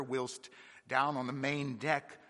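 ordinary delivery

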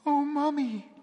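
put-on high, shrill little child spirit; small child calling, pitched far up; shrill child's voice. A narrator's reading. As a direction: pipe it thinly, like a ghostly child. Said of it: "said in a faint, shrill voice".

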